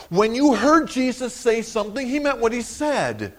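rising, loud, driving sermon voice; fervent preaching. Acted. Preacher to the congregation, passionate full-force preaching. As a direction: preach it hard so it carries to the back pew.

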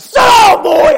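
put-on angry father yelling at caught kids; drill sergeant shout. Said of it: "like a drill sergeant".

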